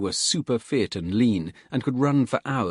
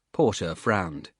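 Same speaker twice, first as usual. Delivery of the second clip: ordinary delivery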